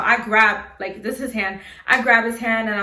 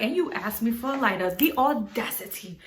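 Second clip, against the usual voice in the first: soft disbelieving mutter to herself; quietly outraged at the nerve; inner indignation; 6 dB quieter than usual. Retold or imitated. To herself about the driver, a soft indignant aside. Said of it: "in my head, I'm like".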